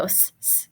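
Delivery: s said as a long hiss, the bare sound; teacher sounding out s; letter sound demo. Shown as a demonstration. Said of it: "S on its own would go".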